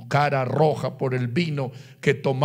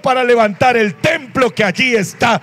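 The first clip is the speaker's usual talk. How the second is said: high-pitched, shouted sermon climax; fervent preaching; 9 semitones higher, 5 dB louder than usual. Real, not acted. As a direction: proclaim it to the whole congregation at full force. Preaching climax at full force.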